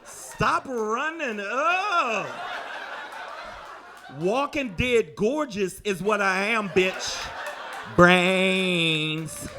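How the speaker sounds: slow, drawn-out sassy zombie; slow hammed-up zombie. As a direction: moan it out like a fabulous zombie.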